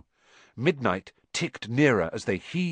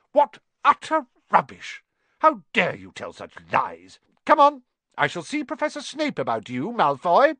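indignant teacher; 10 semitones higher, 5 dB louder than usual. Acted. McGonagall scolding caught Malfoy.

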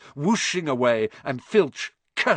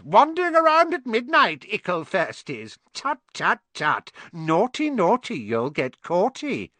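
high, teasing sing-song poltergeist; cackling mischief-maker; 10 semitones higher, 4 dB louder than usual. Acted. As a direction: taunt them with gleeful mock scolding. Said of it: "Peeves cackled".

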